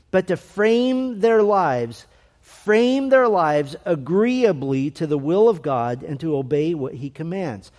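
slow, emphatic quote reading with key words drawn out; measured, forceful reading of a dense passage. Real, not acted. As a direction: read it out deliberately, leaning on key words. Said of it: "I'll read it slowly".

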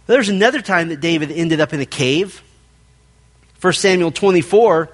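ordinary talk

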